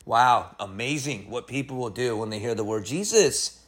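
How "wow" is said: usual speaking voice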